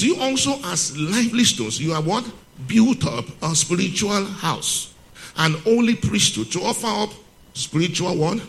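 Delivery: scripture reading. Acted. Preacher reading the verse to the church, prompting answers. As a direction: read the verse up high and draw them in.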